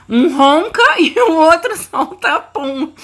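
laughing at her own joke. Real, not acted. Laughing about her snoring dog.

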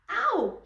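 high, loud storybook shriek of pain; sharp acted cry of hurt. A narrator's reading. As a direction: yelp as if you twisted an ankle. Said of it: "shrieked the prima ballerina".

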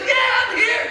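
high shouted boast; loud shout. Retold or imitated. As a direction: shout it out proudly. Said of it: "He's loud".

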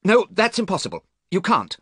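high-pitched, urgent refusal; shout. A narrator's reading. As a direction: cry out to forbid it. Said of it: "shouted Dorian".

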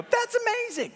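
high, bright-eyed "That's amazing" with a falling finish; amazed exclamation. Shown as a demonstration. Pastor models what to say to your neighbor, amazed. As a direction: show the neighbor your wonder. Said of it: "just look at your neighbor and go".

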